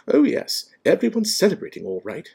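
high, loud, impatient scorn. A narrator's reading. McGonagall sniffing at the celebrations, impatient. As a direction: snap it out with exasperation. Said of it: "she said impatiently".

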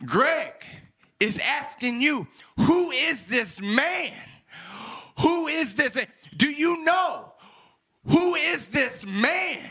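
high, insistent pulpit cry; impassioned preaching. Acted. Preacher cries out the question.

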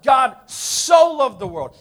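"so" slow and loud, drawn out; stress on so. Shown as a demonstration. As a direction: hammer "so" slowly. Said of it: "Turn to your neighbor and say, "So".

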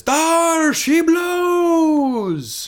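long, drawn-out high bellow; hammed-up lookout cry, very slow and high; sailor's shout. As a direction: holler it across the sea. Lookout calling out in storm.

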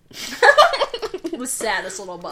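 slow, laughing through words. Real, not acted. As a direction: giggle at the sad bubble. Failed bubble, laughing.

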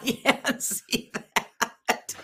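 breathless giggle while speaking; laughing. Real, not acted. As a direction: laugh through the words, slow. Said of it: "Holy fuck".

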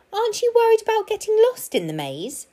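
very high, quick squirrel voice; worried squirrel. A narrator's reading. Squirrel asking the park keeper anxiously, high and quick. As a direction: squeak the question out fast.

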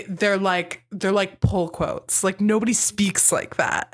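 ordinary delivery

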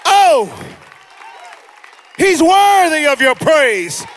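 impassioned pulpit crescendo; fervent, mounting sermon cry; full-force preaching. Real, not acted. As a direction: whip the congregation up into praise.

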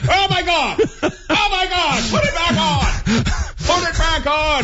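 shocked shouting. Pretend. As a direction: shriek in mock horror. Shocked reaction to shirtless man.

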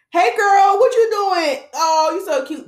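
high, loud, gushing greeting; talking to babies. Shown as a demonstration. Vlogger showing how she greets babies, high gushing chat. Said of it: "That's how I be talking to babies".

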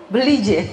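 "J" said firmly, Cirebon style. Shown as a demonstration. Teacher shows Cirebon dialect to listeners, firm "J". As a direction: land firmly on the "J" in "Beli J". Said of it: "J-nya tuh tegas gitu kalau di sini".

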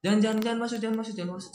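high, urgent mock pleading; retold tearful pleading. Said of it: "Sambil nangis-nangis". (menirukan rengekan memohon sambil menangis)

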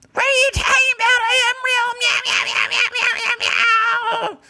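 very high, loud cat screech; high loud catlike meowing; shrieking cat voice. Acted. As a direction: yowl like a deranged cat doctor. Cat character protests, laughing.

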